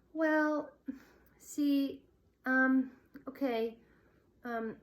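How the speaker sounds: nervous, halting, high stammer. A narrator's reading. Shy girl stammering in front of a boy, nervous halting. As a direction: stumble over every word, flustered. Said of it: "stammered Colleen".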